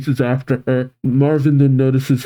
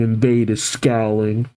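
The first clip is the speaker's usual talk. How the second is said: usual speaking voice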